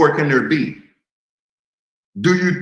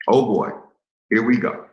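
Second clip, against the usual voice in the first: ordinary talk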